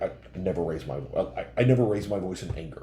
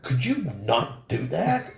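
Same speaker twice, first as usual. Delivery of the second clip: exasperated plea; 7 semitones higher, 3 dB louder, slower than usual. Retold or imitated. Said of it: "And I'm like".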